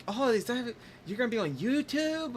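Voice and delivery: high, wide-eyed mock amazement; impressed stranger. Mimicking a stranger who just spotted the camera, high and amazed. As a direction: play the starstruck stranger up high.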